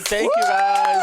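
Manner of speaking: very high, slow squeal; squealed thanks. Real, not acted. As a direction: squeak it out playfully.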